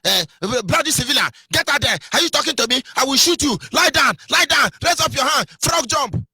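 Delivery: high, loud shouted soldier's orders; bullying soldier. Retold or imitated. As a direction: bark at the driver like an abusive soldier. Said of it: "They begin to hear".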